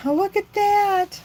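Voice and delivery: high, excited "oh look at that"; thrilled at the result; delighted. Real, not acted. Delighted reveal of stamp.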